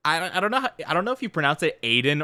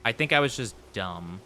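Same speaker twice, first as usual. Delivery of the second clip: usual speaking voice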